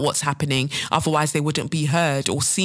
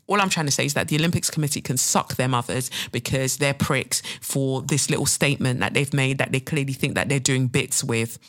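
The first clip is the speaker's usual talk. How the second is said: ordinary talk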